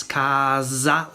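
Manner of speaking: casa slowly as "KAAH-zza"; northern Italian casa, S like a Z; voiced S. Shown as a demonstration. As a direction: draw out casa with a buzzing Z. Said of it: "almost like a Z".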